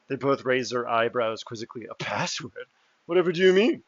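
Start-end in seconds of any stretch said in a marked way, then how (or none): 1.9-3.8 feigned innocence. Acted. NPC feigns ignorance of password.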